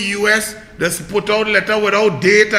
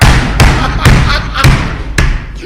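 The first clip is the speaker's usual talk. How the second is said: breathless high cackle; wheezing laugh; 5 semitones higher, 5 dB louder than usual. Real, not acted. Laughing at China's tweet, wheezing cackle.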